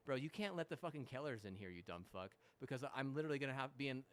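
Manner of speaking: ordinary talk, nothing marked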